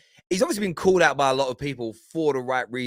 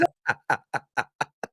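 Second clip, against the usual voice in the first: short burst of chuckles; amused staccato laugh. Real, not acted. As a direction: laugh along at the rant. Laughs after the clip.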